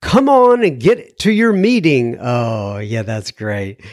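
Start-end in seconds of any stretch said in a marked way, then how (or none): 0.0-2.2 put-on higher, emphatic try at a Glasgow accent; amateur Glasgow accent, pitched up; attempted Glasgow accent. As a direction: have a rough go at a Glaswegian mother urging. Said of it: "in her Glasgow accent".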